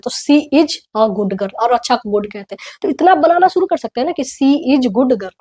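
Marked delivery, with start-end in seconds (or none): 0.1-1.7 slow, deliberate example; slow model sentence. Shown as a demonstration. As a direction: lay out each word slowly. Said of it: "English में हम उसको girl कहते हैं". (धीमे-धीमे उदाहरण)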